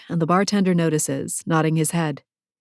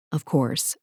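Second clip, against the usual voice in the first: ordinary delivery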